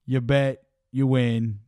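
upbeat sponsor ad read. Acted. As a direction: sell it like a radio spot. Host reads sponsor ad.

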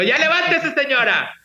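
high, bossy mock wake-up shout; playful wake-up call. Host teasing listeners with grandma's wake-up call, high. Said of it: "siempre les digo".

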